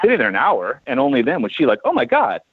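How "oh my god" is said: high-pitched mimicked amazement; imitated high, startled exclamation; astonished gasp, retold. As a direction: act out a friend's sudden discovery. Retells friend spotting the boat.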